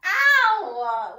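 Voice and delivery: loud yelp then slow complaint; real ow. Real, not acted. Girlfriend hurt by her boyfriend, loud yelp.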